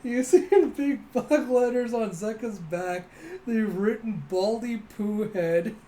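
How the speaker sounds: high, slow, loud, on the edge of cracking up; real amused struggle to speak; barely holding back laughter. Real, not acted. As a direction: force the words out through amusement. Struggling to read joke through laughter.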